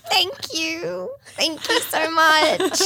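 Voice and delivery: high hammed-up grateful mum; overdone gratitude. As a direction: gush thanks to the son, slowly. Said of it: "I'm like".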